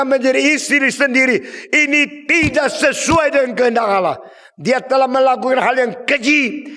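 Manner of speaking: high, loud thundering denunciation; John the Baptist thundering at Herod; fiery prophet rebuke. Acted. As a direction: preach it like a prophet condemning the king.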